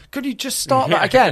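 high, sing-song mock request to fans mid-chant; mock polite request. Filmmaker mocking asking chanting fans for a retake, high and sing-song. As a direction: ask them sweetly to redo the chant, tongue in cheek. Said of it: "you can't go over and be like".

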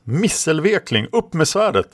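high, scolding battle command; roared order. A narrator's reading. Fosse scolds Karlis before the clash, high roared order. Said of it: "röt Fosse till Karlis".

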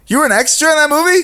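very high, fast disbelieving squeal; can't believe he was in the film; astonished question. Real, not acted. Disbelief at friend's claim.

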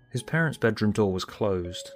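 ordinary delivery

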